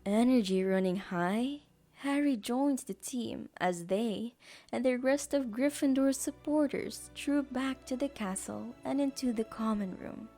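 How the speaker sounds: ordinary delivery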